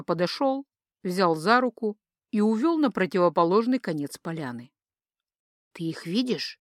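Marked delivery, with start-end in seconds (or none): 5.7-6.6 hushed, softer voice; whispered question. A narrator's reading. Girl unable to keep silent asks husband, hushed. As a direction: ask it under your breath, softly. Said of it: "спросила шёпотом Илика".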